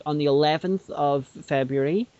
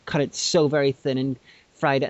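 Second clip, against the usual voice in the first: ordinary delivery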